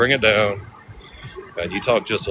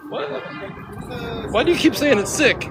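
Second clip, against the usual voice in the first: puzzled New Yorker; 12 semitones higher than usual. Retold or imitated. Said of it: "people don't say that in New York".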